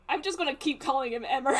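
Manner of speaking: fast, laughing through the words. Real, not acted. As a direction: giggle it out quickly. Player laughing at own slip.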